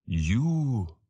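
drawn-out, weighty address; single out the listener slowly; slow old dragon. A narrator's reading. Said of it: "in his slow voice".

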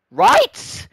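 sudden high, loud outburst; exasperated shout. Real, not acted. Banned player ranting about lost free speech, exasperated shout.

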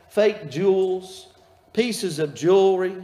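ordinary talk, nothing marked

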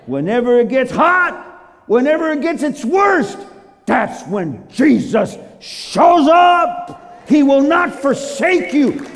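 loud, high, drawn-out key phrases; fiery preacher driving it home; preaching at full force. Real, not acted. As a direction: belt out the promise to the congregation.

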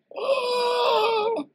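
very high, loud mock scream; long shrill comic shriek; Kevin's panicked scream. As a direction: scream like a terrified kid running away. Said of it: "Kevin in Home Alone".

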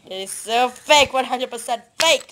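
loud accusing. Real, not acted. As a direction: call it out loudly. Repeated louder.

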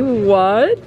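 normal talking voice

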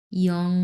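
"ইয়ং" said as "i-ong", a glide into the vowel; pronunciation demo. Shown as a demonstration. Teacher showing students how to pronounce ING, a careful model "ইয়ং". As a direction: sound out "ইয়ং" on its own. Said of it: "ইয়ং উচ্চারণ করি কিন্তু".

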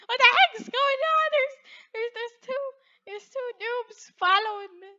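lightly mocked player, high-pitched bafflement; imagined bewildered player. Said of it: "He's like".

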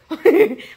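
loud burst of laughter; real laugh. Real, not acted. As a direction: laugh out at your own joke. Laughs at own act.

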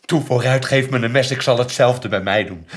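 high, very loud frantic raving; hysterical outburst. Acted. Mother demands a knife to hurt herself, hysterical. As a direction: scream it out, out of control.

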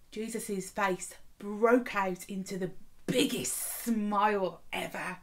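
ordinary delivery

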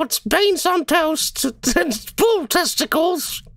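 high, slow, hammed-up posh British foodie; jokey high-pitched British TV chef voice; mock British accent. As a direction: play a mock Gordon Ramsay-style British taster. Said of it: "Gordon Ramsay once did a segment".

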